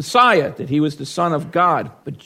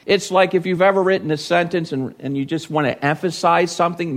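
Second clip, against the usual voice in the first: ordinary talk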